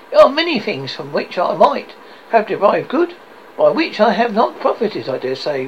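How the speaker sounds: high, loud, lively declaration; earnest nephew. Acted. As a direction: speak up eagerly for Christmas.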